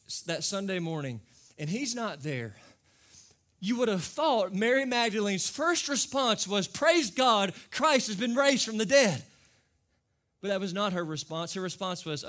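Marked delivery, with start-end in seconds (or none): 3.6-9.2 loud, high, building preacher's cry; preaching at full force. Real, not acted. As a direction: lift the room with it.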